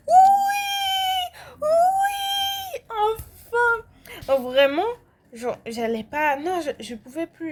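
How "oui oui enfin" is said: very high, cracking cry of relief; relieved cheer. Real, not acted. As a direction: shriek with joy that it's finally over. Cheering when the game ends.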